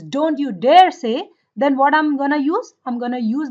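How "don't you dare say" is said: "don't you dare say" loud and slow, DARE leaned on; heavy emphasis. Shown as a demonstration. Teacher showing students how emphasis forbids, heavily stressed. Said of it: "if I want to say with so much emphasis".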